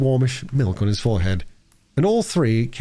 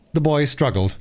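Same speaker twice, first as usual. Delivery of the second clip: usual speaking voice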